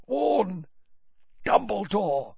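straining madman's warning; struggling plea. A narrator's reading. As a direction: force out the warning. Crouch struggling.

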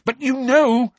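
high, loud shout; shouted accusation. A narrator's reading. Boy accusing the elf, shouting. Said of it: "shouted Harry".

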